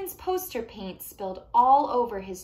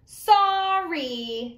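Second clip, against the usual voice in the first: higher, louder grudging snap; insincere angry sorry; 7 semitones higher, 8 dB louder than usual. Acted. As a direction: spit out a sorry you don't mean. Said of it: "He's not using an I'm sorry voice".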